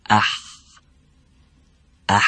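اح with a slight catch in mid-throat; throaty ح demo. Shown as a demonstration. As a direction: squeeze اح from the middle of the throat. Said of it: "دقت کنید باز".

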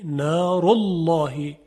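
نار الله slow, with the lam of الله thick after the u; heavy lam. Shown as a demonstration. Teacher models the heavy lam after a damma.

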